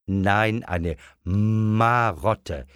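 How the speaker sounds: "Marotte" slowly stretched from its first sound; explaining. A narrator's reading. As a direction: correct gently by drawing out "Marotte". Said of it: "erklärte die Mutter". („Marotte“ erklärend langgezogen)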